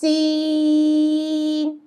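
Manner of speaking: سین held long with a whistling s; drawn-out seen. Shown as a demonstration. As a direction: stretch سین with a firm hiss. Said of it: "ایک دفعہ پھر بتاتی ہوں".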